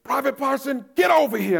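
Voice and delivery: high, loud drill sergeant shout; drill instructor bark. Retold or imitated. Drill instructor summoning the private again, loud bark. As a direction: bark the name across the yard. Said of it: "The drill instructor says".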